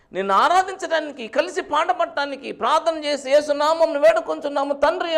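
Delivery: loud, high mock plea in prayer; imitated lament. Exiled John laments to God without congregation, imitated loud lament. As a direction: cry out John's lament as a preacher retells it. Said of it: "ఏంటి ఆయన ఏడుపు ఏంటి?".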